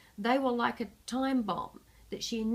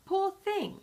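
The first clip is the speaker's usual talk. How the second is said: mock pity, pitched much higher; unconvincing sympathy; 11 semitones higher, 3 dB louder than usual. A narrator's reading. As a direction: fake 'poor thing' with no real feeling. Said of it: "he added unconvincingly".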